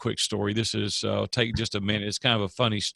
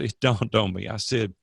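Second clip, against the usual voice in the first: ordinary delivery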